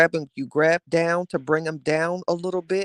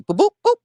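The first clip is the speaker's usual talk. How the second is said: squeaky high boop boop boop, much louder; high boop noises; 8 dB louder, faster than usual. Pretend. Miming quick blows to viewers, high loud boops. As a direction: pop out three high cartoon boops.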